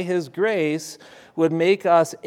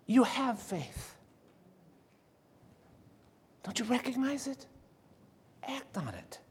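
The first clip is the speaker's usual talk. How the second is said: tender and hushed; 6 semitones higher, 13 dB quieter than usual. Acted. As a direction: gently reassure them, ending in a whisper. Jesus' gentle rhetorical question meant.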